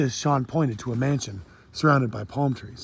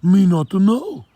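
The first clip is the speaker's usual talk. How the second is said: slow, louder, higher Jamaican shrug; Jamaican accent; 7 semitones higher, 7 dB louder than usual. Acted. Kofi shrugs to Sean, Jamaican accent. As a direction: Jamaican patois, no idea. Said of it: "with a heavy accent also".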